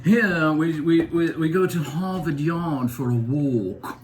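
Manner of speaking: mock Boston voice, slow; Boston accent. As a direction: lightly poke fun at Boston speech. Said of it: "They don't pronounce their L's".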